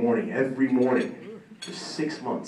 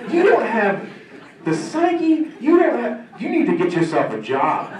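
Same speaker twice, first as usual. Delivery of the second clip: mock coach rant; 11 semitones higher, 6 dB louder than usual. Coach rants against acting career.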